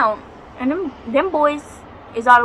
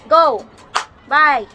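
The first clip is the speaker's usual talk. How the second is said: sharp, raised shooing shout; shooing away; 4 semitones higher, 5 dB louder than usual. Real, not acted. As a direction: call out higher and louder to shoo them off.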